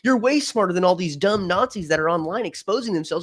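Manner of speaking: high, mocking mock-admiration; sarcastic praise. Real, not acted. Sarcastic praise of supposed smart people.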